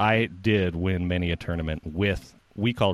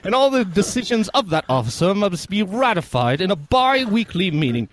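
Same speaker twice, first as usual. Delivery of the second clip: higher, louder sketch voice; peasant speech quote; 11 semitones higher, 5 dB louder than usual. Retold or imitated. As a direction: recite it like a smug peasant. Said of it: "got some words wrong".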